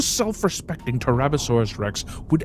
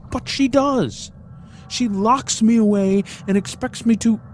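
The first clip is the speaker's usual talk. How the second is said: indignant; 10 semitones higher, 4 dB louder than usual. A narrator's reading. Captive bunny complaining about its owner, indignant. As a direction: squeak out the injustice.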